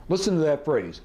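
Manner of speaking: ordinary delivery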